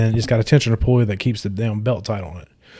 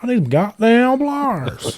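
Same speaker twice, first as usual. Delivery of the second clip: mock high voice; 11 semitones higher, slower than usual. Reenacting plier plea.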